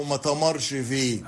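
ما تمرش فيه with t for th, "ma tamarsh fih"; everyday Egyptian ما تمرش فيه, t and final sh; Egyptian colloquial negative. Shown as a demonstration. Linguist gives the colloquial negative, ما تمرش فيه said with t. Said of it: "موجود في العامية المصرية".

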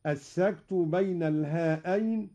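usual speaking voice

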